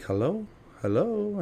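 rising, puzzled hello; questioning phone greeting. Retold or imitated. High kid answers a phone that isn't ringing, puzzled. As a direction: call into a silent phone, pitch climbing.

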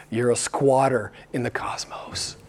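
breathy whisper, like a secret; hushed whisper. Acted. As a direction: lean in and whisper it. Whispered aside in sermon.

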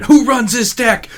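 high, loud, slow hype man call. Retold or imitated. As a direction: pump up the crowd like a hype man. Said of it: "just sounds like a hype man".

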